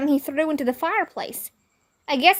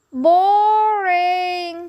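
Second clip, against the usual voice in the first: high, loud drawn-out boring; louder kid's jeer; yelled heckle; 6 semitones higher, 4 dB louder than usual. A narrator's reading. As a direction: heckle from the crowd. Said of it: "somebody yelled".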